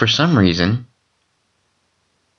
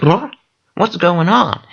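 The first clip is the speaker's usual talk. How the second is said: high startled disbelief; shocked; 9 semitones higher than usual. Retold or imitated. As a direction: blurt it out, stunned. Dreamer shocked by brutal beating.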